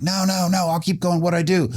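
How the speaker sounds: very high, acted-out protest; desperate pleading. Pleads to keep going.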